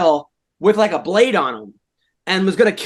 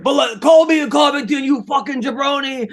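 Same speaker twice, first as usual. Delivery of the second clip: Iron Sheik impression; 7 semitones higher than usual. Friend impersonates a wrestler cutting a promo, high shouting. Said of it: "the Iron Sheik was like".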